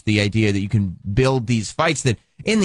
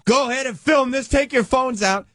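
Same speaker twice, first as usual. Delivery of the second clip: mock yell; 13 semitones higher than usual. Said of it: "hears himself yelling".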